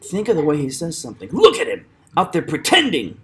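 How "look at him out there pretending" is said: higher acted line, "pretending" punched on "tend"; indignant line peaking on the "tend" of "pretending"; stress on TEND. Shown as a demonstration. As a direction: hit the middle of "pretending". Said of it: "He stresses the tend in pretending".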